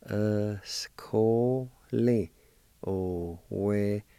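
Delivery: "Escole o Westane" drawn out very slowly. Shown as a demonstration. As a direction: stretch out "Escole o Westane" syllable by syllable. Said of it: "And together".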